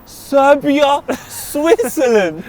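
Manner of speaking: high, loud and drawn out with a laugh; excited. Real, not acted. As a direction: savour the rematch slowly, laughing. Excited about the Serbia–Switzerland rematch.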